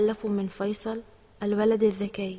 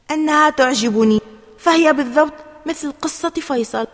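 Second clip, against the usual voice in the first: loud, higher, emphatic insistence; insistent; 4 semitones higher, 9 dB louder than usual. A narrator's reading. Ali defending his haircut to his mother, insistent. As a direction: stand your ground loudly. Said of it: "أجاب علي بإصرار".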